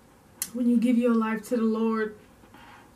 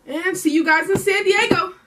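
excited sign-off; 7 semitones higher, 5 dB louder than usual. Real, not acted.

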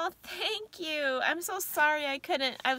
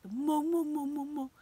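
cooing the name; 4 semitones higher than usual. Retold or imitated. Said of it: "I have a habit of doing that to her now".

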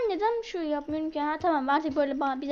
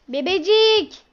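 put-on loud call to baby; 3 semitones higher, 8 dB louder, much slower than usual. Calling the baby.

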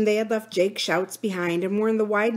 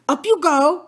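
raised, louder, worried command; anxious urging; 8 semitones higher, 6 dB louder than usual. A narrator's reading. Philip sending the girls up the shaft, anxious. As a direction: urge them up anxiously. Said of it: "said Philip anxiously".

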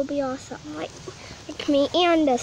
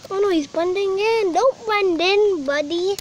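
excited pleading; 4 semitones higher, 5 dB louder than usual. Real, not acted. Kid pleading with bug.

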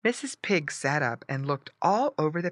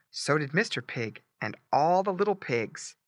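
ordinary delivery

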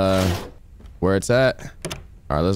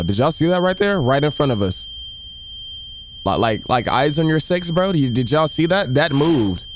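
scared horror game streamer; 5 semitones higher than usual. Real, not acted. Startled by something moving.

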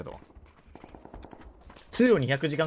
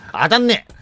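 loud, higher outburst; frustrated shout; 9 semitones higher, 10 dB louder, slower than usual. Real, not acted. Gamer whose shots miss, frustrated shout.

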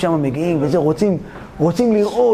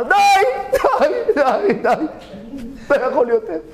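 high, breathless laughing pleas; king laughing helplessly; 6 semitones higher than usual. Acted. King cracking up at the two friends fighting over the rope, laughing. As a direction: laugh so hard you can barely get the words out. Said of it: "המלך אמר".

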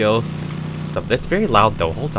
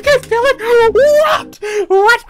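shrill startled shriek, much higher and louder; screaming; 4 dB louder, much slower than usual. Real, not acted. Player shrieking at a surprise in the update. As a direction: yelp it out in disbelief. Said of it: "I'm screaming this whole video".

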